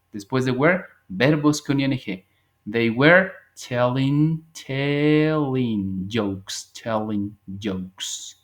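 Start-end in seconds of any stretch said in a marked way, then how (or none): 2.7-4.4 spoken very slowly; slow model sentence. Shown as a demonstration. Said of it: "repite despacio como modelo". 4.5-8.4 "telling" with a clear t sound, slow; careful pronunciation. Shown as a demonstration. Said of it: "Decimos telling".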